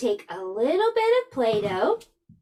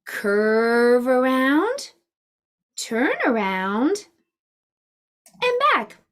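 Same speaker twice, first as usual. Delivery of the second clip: slow guided instruction; slower than usual. Shown as a demonstration. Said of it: "Let's practice writing".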